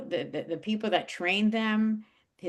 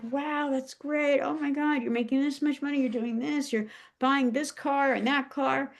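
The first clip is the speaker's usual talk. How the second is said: higher, gushing praise; gushing admirers; 7 semitones higher than usual. Retold or imitated. Friends gushing over her money and cars, high and impressed. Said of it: "people were like".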